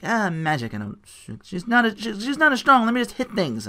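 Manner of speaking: put-on a kid brushing off magic; younger self. Mocking his younger self who only wants to hit things, high and whiny. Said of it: "when I was younger, I was just like".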